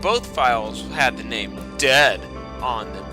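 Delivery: ordinary talk, nothing marked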